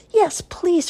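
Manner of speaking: high, loud eager yes; little boy eagerly accepting mommy's idea, high and loud. Acted. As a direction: jump at the offer. Archie eager for his own bag.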